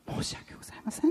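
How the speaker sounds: whispered, quick sorry; whispered apology. Retold or imitated.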